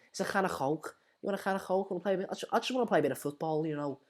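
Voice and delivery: light-voiced mock Scouse; Scouse impression. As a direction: do a Scouse voice, throaty on the k sounds. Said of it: "the best impression I can do".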